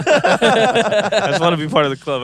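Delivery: laughing. Real, not acted. Joking plea after kidding.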